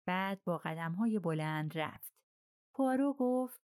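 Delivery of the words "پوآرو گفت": plain reading voice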